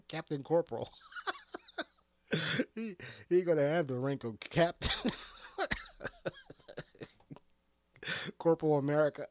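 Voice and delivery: high laughter through words; laughing. Real, not acted. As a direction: crack up at your own joke. Laughing at own joke.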